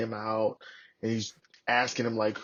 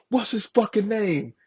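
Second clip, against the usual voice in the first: slow, high-pitched angry demand; cussing out; 10 semitones higher, 3 dB louder than usual. Retold or imitated. Sergeant major demanding the buddy's name, imitated slow angry demand. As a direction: imitate an irate superior drawing out the question. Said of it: "He's cussing us out".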